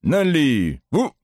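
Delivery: slow, high drawn-out command; shouting drill sergeant. A narrator's reading. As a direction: stretch the order like a parade call.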